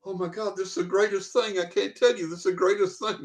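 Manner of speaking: half-laughing, mimic a teacher who can't stop laughing. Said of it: "She's laughing so hard".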